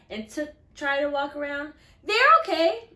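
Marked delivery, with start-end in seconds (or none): 2.0-2.9 high, loud, too-eager fib; forced cheer. Acted. Jeremy hiding tight shoes, loud forced cheer. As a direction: cover the pain with a bright lie. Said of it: "holding my breath".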